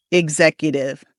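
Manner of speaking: "Executive" with x voiced as gz. Shown as a demonstration. Coach asking students to say it with me, GZ sound. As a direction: model "Executive", opening ig-ZEK. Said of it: "G sound and the Z sound".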